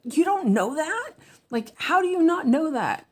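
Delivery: high-pitched disbelieving outburst; exasperated yelling. Retold or imitated. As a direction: call out in disbelief at the screen. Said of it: "yelling at the screen".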